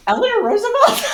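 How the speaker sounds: high, laughing mock answer; laughing quote. Friend answering her in the car, laughing. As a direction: blurt it out through laughter. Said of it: "And he was like".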